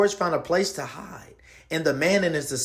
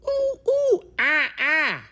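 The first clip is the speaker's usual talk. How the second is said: very high monkey ooh-oohs, then ah-ahs; monkey hoots; 12 semitones higher, 3 dB louder than usual. Acted. Monkey spots the empty griddle, excited hooting. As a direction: squeal like an excited little monkey. Monkey George gets an idea.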